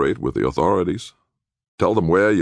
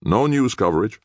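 lawyer's firm statement, raised pitch; firm refusal; 9 semitones higher, 3 dB louder than usual. Acted. Public defender refusing Nick's request, firmly. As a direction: rule it out as settled.

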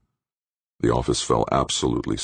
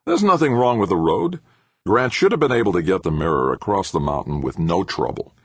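higher, defensive rebuttal; countering; 6 semitones higher, 3 dB louder than usual. A narrator's reading. Engineer defending his road to the professor, countering firmly. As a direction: push back against the blame. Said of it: "Shaking his head, Obermann countered".

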